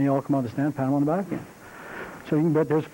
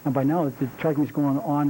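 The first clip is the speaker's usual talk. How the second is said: usual speaking voice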